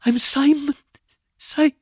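high, loud terrified squeak; panicked squeaky stammer. A narrator's reading. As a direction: force it out, high and strangled. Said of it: "afraid my voice would come out as nothing but a squeak".

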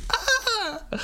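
high cry of embarrassed horror; shrieking ah. Real, not acted. He realises she misheard him, shrieking high. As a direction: yelp it out high.

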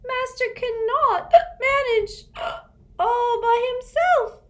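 high, loud, slow gasping elf; a distraught elf between sobs; sobbing elf. Acted. Winky wailing about her master, gasping sobs.

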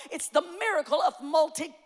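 normal talking voice

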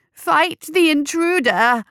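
high, loud, drawn-out battle cry; slow, high-pitched hollered command; cried out. A narrator's reading. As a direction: stretch out a rallying yell. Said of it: "cried McMorning".

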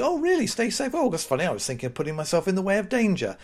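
mock-surprised high sarcastic reply; sarcastic retort. Replying to a stay-safe email with mock surprise. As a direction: play dumb with heavy sarcasm. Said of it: "You say".

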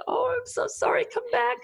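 very high, loud pleading call; high-pitched sorry shouted down the stairs; frantic apology. Retold or imitated. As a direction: call after her, desperate to undo it. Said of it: "I said".

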